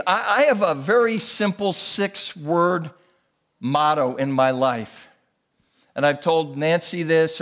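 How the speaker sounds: ordinary talk, nothing marked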